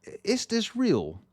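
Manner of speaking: stunned by the final section; amazed disbelief. Retold or imitated. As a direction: ask as if you cannot believe your ears. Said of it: "I'm just like".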